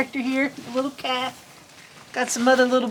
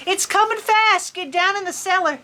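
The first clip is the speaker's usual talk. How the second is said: high-pitched mock shout of warning; farmer shouting; 7 semitones higher than usual. A narrator's reading. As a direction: call out the storm warning in a rush. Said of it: "the farmer shouting in the distance".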